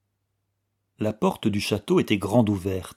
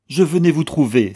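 loud, higher-pitched exclamation; exclaiming; 5 semitones higher, 8 dB louder than usual. A narrator's reading. Manske meets Anna on the path, exclaiming. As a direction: call out on meeting her. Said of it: "s'écria-t-il".

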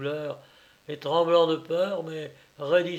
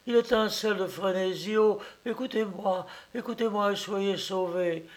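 higher, urgent begging; imploring aunt; 6 semitones higher, 3 dB louder than usual. A narrator's reading. Kneeling aunt implores nephew to save himself, higher urgent begging. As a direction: implore him on her knees. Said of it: "Comme elle s'agenouillait devant moi".

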